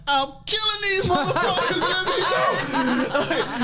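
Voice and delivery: high-pitched retold threat, laughing around it; mock enraged yell. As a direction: act out raging to break free. Retold threat while being held back.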